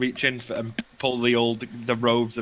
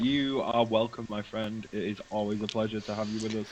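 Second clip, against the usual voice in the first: lower, softer, courteous hostess voice; gracious host; 4 semitones lower, 8 dB quieter than usual. Acted. Rose graciously welcomes her guests in a low, soft, courteous voice. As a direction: answer like a polite innkeeper. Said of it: "and it's like".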